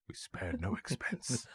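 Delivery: put-on slow, soft, proud old showman; Attenborough impression. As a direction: savour each word like a proud host. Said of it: "Dickie Attenborough keeps saying".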